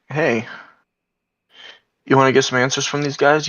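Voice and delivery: ordinary delivery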